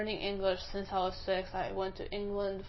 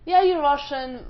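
mocking quote; 6 semitones higher, 12 dB louder, slower than usual. Mimicking the rude guy.

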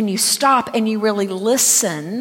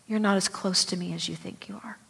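softer, breathless whisper of a voice heard inside; whispered voice of God; 2 semitones lower, 10 dB quieter than usual. Retold or imitated. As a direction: hush it like a word spoken inside the heart. God speaking in quiet time.

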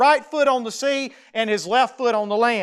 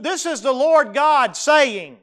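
ordinary talk, nothing marked

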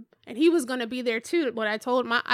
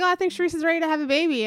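louder, higher, quick quote; raised-volume retold remark; speaking up; 5 semitones higher, 5 dB louder, faster than usual. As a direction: repeat it quickly so it can be heard. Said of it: "am I talking too low?".

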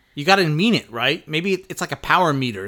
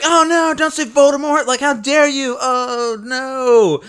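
mock panic; 11 semitones higher, 6 dB louder than usual. Mocking panicked Death Eaters.

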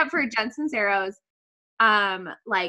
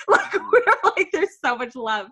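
teary laugh; 6 semitones higher, slower than usual. Real, not acted. Laughing through tears.

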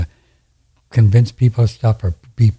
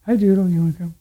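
high, soft call to a pet; sweet pet talk to the golden doodle lying nearby; talking to the dog; 8 semitones higher, 4 dB quieter, faster than usual. Real, not acted. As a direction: coax the dog over gently.

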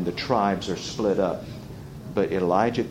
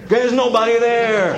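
high, loud punchline shout; emphatic shout; 12 semitones higher, 5 dB louder than usual. Real, not acted. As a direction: belt out the answer, pitch way up. Preacher shouts answer to own question.

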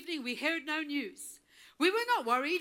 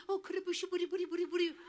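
mocking timid tongues; 6 dB quieter, much faster than usual. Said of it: "We were not doing".

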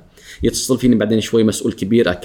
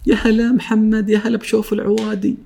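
high, slow, beaming greeting; mock cheerful boss; 9 semitones higher than usual. Moody boss greeting his employee warmly, high and slow. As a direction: gush like a boss in a great mood.

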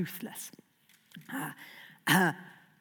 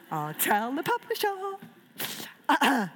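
drawn-out, higher playful threat; slow mock-ominous; 5 semitones higher, much slower than usual. Real, not acted. Author jokingly threatening to report back to the publisher. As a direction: stretch it like a teasing warning.

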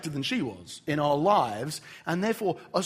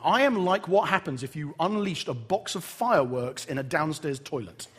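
ordinary talk, nothing marked

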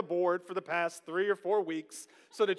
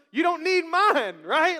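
Peter; 9 semitones higher, 10 dB louder, slower than usual. Acted. Acting out Peter's reply to Jesus.

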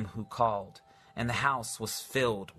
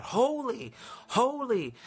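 much higher, ringing cry of holy; 11 semitones higher, slower than usual. Acted. Seraphim dipping down and crying out, high cry. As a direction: lift it up high like an angel's cry. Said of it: "and they're crying out".

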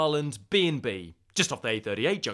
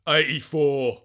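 hammed-up veteran of the miners' strike; put-on tough-guy voice; 4 dB louder than usual. Recalling eighty-four to colleague, put-on tough voice. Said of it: "Why you got a voice?".

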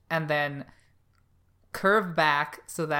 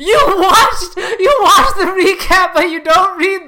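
high, loud incredulous laughing; 12 semitones higher, 17 dB louder than usual. Real, not acted. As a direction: laugh it out in disbelief. Teasing friend who skipped text.

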